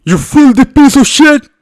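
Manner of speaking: furious shout. Acted. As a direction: scream at the offender. Shogun rages at the player.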